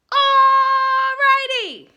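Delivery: huge high sung-out bellow. Acted. The boy bellows to stop the tornado, hugely loud. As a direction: belt it out to shake the land. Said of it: "he bellowed".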